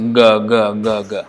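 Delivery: g said the same for every velar; imitating flat g. Teacher imitating speakers who say only g. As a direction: flatten all velars to g. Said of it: "everything is pronounced as g".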